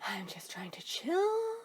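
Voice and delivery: whispered, slow and resigned; a tired whisper to yourself. Real, not acted. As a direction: whisper it like you're done with everything.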